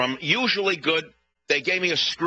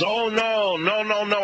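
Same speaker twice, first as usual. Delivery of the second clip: protesting owner; 8 semitones higher, slower than usual. Retold or imitated. Restaurant owner denying cheating.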